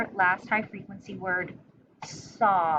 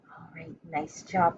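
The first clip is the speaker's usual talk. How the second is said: ordinary talk, nothing marked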